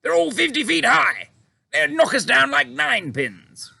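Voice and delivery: military men. A narrator's reading. Generals objecting to the Queen, high anxious voices. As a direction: protest like nervous officers. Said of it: "the two military men said together".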